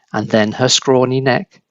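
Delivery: ordinary delivery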